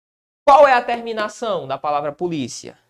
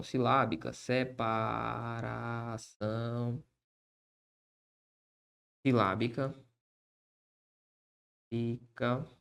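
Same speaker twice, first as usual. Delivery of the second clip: slow, soft dictation to the board; dictating while writing; 8 semitones lower, 8 dB quieter, slower than usual. Shown as a demonstration. Teacher dictating the rule as he writes it, slow and soft. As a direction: spell it out slowly as you write.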